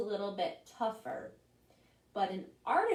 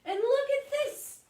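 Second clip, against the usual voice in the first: much higher and louder, eager show-off; excited tomato; 6 dB louder than usual. Acted. Tomato shows off his X-ray, high and excited. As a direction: shove the X-ray forward proudly. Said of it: "says the tomato".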